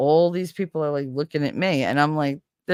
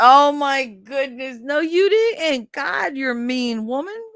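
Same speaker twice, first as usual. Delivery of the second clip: high, slow, drawn-out gasp of disbelief; shocked disbelief; 8 semitones higher, 4 dB louder, slower than usual. Real, not acted. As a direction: stretch it out, mock-scandalised. Shocked reaction to a mean prank.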